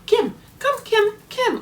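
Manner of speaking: put-on high little-kid calling out; toddler calling. Imitating Gabe calling his foster mom by name in a high toddler voice. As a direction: call her name like a small boy. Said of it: "He would say".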